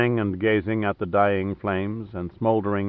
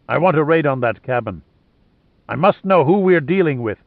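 lightly voiced commander, higher and firm; a touch of insistence, slightly louder; commanding Lohan; 5 semitones higher, 5 dB louder than usual. Ordering a raid over the radio, mildly commanding.

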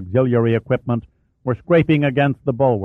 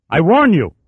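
louder, higher threatening shout; warning shout; 7 semitones higher, 6 dB louder than usual. Acted. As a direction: bark the warning. Captain threatens his officer.

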